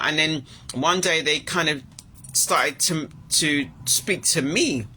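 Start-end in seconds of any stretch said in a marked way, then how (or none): none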